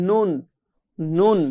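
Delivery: নুন with tongue tip on palate; touch tongue tip up for নুন; demo letter. Shown as a demonstration. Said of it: "এই তালুর সাথে লাগিয়ে". (জিহ্বার আগা তালুতে লাগিয়ে নুন)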